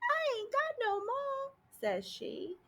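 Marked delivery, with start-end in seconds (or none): 0.0-1.5 higher, slow, softer, weepy; bawling little girl. A narrator's reading. Orphan bawls to the sheriff, higher, slow and weepy. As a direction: wail it like a crying orphan. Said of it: "she went right on bawling".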